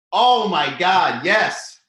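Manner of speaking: delighted slow exclaim; excited. Real, not acted. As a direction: drag it out in delight. Excited agreement with a viewer's tip.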